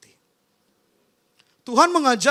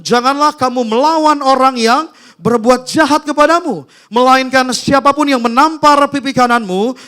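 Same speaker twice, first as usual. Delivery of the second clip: booming, measured Bible reading from the pulpit; forceful scripture reading; 9 dB louder than usual. Acted. Pastor reading Jesus' words to the congregation, booming. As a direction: proclaim the verse to the congregation.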